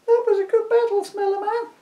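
a high light woman's voice; female voice. Acted. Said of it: "it said very quietly".

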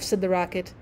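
usual speaking voice

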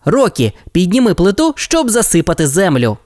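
put-on brief the team like a young captain; lightly voiced Ryder. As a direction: Ryder gives the pups their mission. Ryder instructs Rocky.

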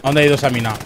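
usual speaking voice

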